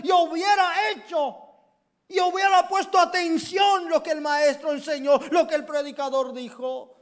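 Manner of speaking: high, loud shouted regret; anguished shout. Acted. Bible student regrets ignoring the preacher, shouting. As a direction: scream the regret out.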